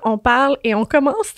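ordinary delivery

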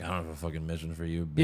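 quick, soft, dismissive mutter of a line; mock CIA guy brush-off. CIA guy dismissing Grace, a fast soft put-down. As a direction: toss it off fast and low, no time for her. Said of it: "And he's like".

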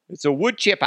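put-on high Boston accent sliding into Australian; hammed-up, off-target Boston accent; botched Boston accent. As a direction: attempt Boston, land near Australian. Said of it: "like, Australian kind of thing".

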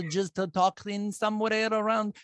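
badly faked all-purpose Eastern European accent; mock Eastern European accent. Shown as a demonstration. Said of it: "Just Eastern European?".

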